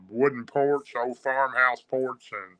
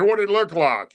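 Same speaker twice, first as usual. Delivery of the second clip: higher, louder eager question; curious question; 8 semitones higher, 4 dB louder than usual. Retold or imitated. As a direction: ask it with sudden interest. Granddad asks, curious.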